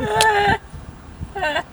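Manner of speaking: high-pitched burst of laughter; helpless cackle; laughing. Real, not acted. Listener reacting to the funny story, laughing.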